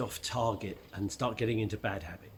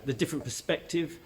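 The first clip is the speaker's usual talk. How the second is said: normal talking voice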